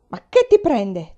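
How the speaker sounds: loud, drawn-out angry outburst; thundering. A narrator's reading. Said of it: "tuonò".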